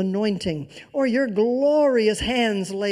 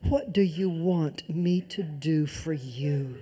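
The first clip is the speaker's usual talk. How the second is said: slow, lower, soft and caring; tender Jesus; 6 semitones lower, 6 dB quieter, slower than usual. Acted. Jesus asking the blind beggar, slow and tender. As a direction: ask it slowly like a gentle offer. Preacher voicing Jesus, drawn out.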